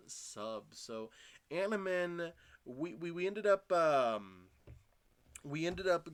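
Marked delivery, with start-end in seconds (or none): 1.5-6.1 louder, higher celebratory call; rally the fans with excitement; loud, high excited announcement; hyped shoutout. Real, not acted. Hyped address to community.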